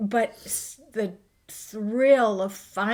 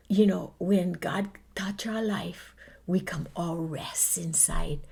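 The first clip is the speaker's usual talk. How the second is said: soft whisper; 5 semitones lower, 6 dB quieter than usual. Real, not acted.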